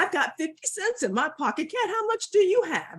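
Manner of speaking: eager dog. Acted. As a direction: play Dog keen on a plan. Dog excitedly asks Cat in story.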